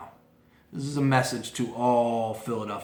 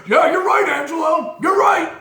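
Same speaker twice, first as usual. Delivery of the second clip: much higher, loud hammed-up shout of agreement; mocking yes-men callers; 9 dB louder than usual. Callers fawning over host Angelo, hammed-up high shout. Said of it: "you get those cast of thirty, dirty thirty".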